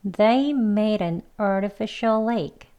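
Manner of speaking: slow, careful English practice sentence; slow model reading. Shown as a demonstration. Teacher gives first example sentence, slow model reading. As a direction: read it slowly and clearly for learners. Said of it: "빨리도 두 번 해보겠습니다".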